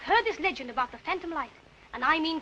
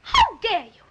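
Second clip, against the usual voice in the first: louder, offended snap; outraged young lady, louder; indignant; 4 semitones higher, 9 dB louder, slower than usual. Acted. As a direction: take real offence. Offended by his insinuation.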